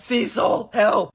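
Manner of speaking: high, loud cry for help; half-acted panicked call; retold yell for help. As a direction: shout for the mentor as if desperate. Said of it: "yelling out".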